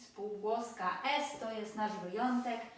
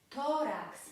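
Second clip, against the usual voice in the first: Tórax with a prominent first syllable; penultimate stress; 3 semitones higher, 3 dB louder than usual. Shown as a demonstration. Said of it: "akcent właśnie pada na".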